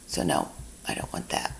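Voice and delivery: breathy muttered aside to herself; whispered aside. Real, not acted.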